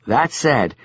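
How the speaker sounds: usual speaking voice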